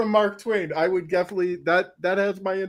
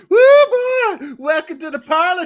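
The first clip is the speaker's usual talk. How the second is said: higher, louder mock Southern welcome; yokel's leering greeting; 11 semitones higher, 10 dB louder than usual. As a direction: lure him in like a hammed-up hillbilly. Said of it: "he's like".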